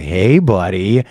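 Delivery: low, slow come-hither call; inviting window lady. Retold or imitated. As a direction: lure a passer-by in, slow and low. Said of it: "they're kinda like".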